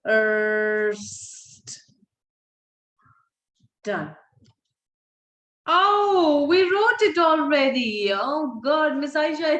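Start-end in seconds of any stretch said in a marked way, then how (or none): 5.7-9.6 sudden high, louder oh of surprise; surprised. Real, not acted. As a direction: catch your own mistake out loud. Teacher surprised by own mistake.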